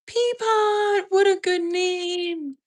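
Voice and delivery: very high, drawn-out coo; delighted cooing. Real, not acted. Streamer admiring a viewer's dog name, high delighted coo. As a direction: gush over an adorable name, high and slow.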